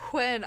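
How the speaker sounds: When said as hwen, the H breathed first; hwen, a light mock of grandma; H before W. Shown as a demonstration. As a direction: put an H breath ahead of When. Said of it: "they always put the H before the W".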